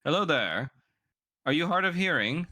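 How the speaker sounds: high, affected upper-class voice; haughty noblewoman. Acted. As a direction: talk down to him like a grand lady. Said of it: "just goes like".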